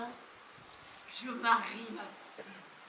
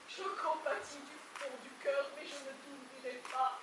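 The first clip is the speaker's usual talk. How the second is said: hushed apology; 12 dB quieter than usual. Acted. Wife quietly refuses Bobby at the door, hushed. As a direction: keep it down as if not to be heard.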